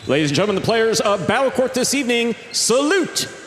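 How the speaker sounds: big ring-announcer delivery, high-pitched with drawn-out names; arena announcer. Acted. Announcer introducing the teams to the fans, booming showman delivery. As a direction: stretch each player's name out like a proud arena announcer.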